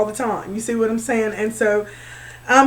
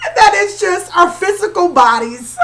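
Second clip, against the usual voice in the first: higher pitch, giggles breaking in; laughing; 6 semitones higher, 4 dB louder than usual. Real, not acted. Explaining her laughing fit to viewers, laughing. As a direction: talk through real laughter. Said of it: "that's why I'm laughing so deep".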